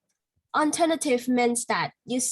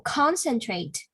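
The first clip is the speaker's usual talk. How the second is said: "concentrate" with the first syllable drawn out and stressed; slower than usual. Shown as a demonstration. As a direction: stress CON in "concentrate", slowly. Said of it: "the first stress on the first syllable".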